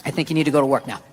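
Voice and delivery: low, measured mock composure; remained calm. Husband telling wife to go to work, deliberately calm. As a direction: drop low and pause, staying utterly calm. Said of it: "I just remained calm".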